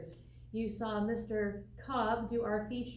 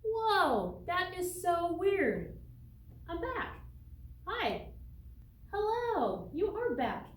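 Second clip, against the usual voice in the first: much higher excited twin voice; twin sister Stella; 7 semitones higher, slower than usual. Pretend. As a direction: play the bubbly twin sister. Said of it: "call Stella in to help us out".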